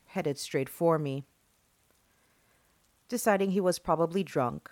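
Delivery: usual speaking voice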